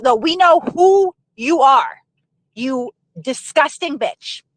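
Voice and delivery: slow, drawn-out contempt; deliberate, scornful put-down; contemptuous. Real, not acted. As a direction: spell it out slowly, then drop the insult with disgust. Addresses absent Karen with contempt.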